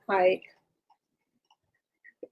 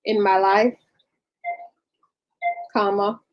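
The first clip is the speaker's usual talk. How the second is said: halting dictation, speaking the punctuation aloud; dictating. Real, not acted. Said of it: "hear me dictating".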